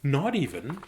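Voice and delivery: ordinary delivery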